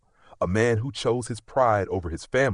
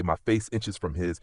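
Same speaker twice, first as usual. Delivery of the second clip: usual speaking voice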